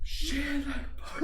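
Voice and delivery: high, voiceless hissed curse; strained whispery exclamation of panic; mock dread. Reenacting watching traffic swerve around them, whispery panicked curse. Acts out stranded group's dread.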